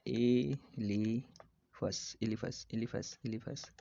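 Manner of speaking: Elaphus syllable by syllable; slow dictation. Shown as a demonstration. Teacher repeats elephant's name for students, syllable by syllable. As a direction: hold and separate each syllable of elaphus.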